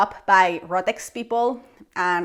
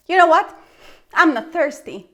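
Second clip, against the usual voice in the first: much higher, louder mock defiance; defiant refusal; 8 semitones higher, 8 dB louder than usual. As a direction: declare it proudly, refusing. Refusing to buy water.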